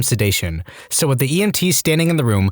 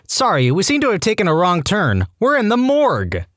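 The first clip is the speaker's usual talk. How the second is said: mock shout; 7 semitones higher than usual. Young patient calls to the hospital porter, mock shouting. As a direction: call out in put-on dismay. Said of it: "and shouted".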